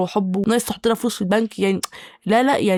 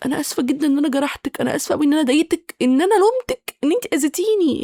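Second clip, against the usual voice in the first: over-apologetic people pleaser; 5 semitones higher than usual. Retold or imitated. People pleaser apologizing to friend who hurt her, pitch climbing higher. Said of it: "فطبعًا كبير بليزر بيبقى الرد".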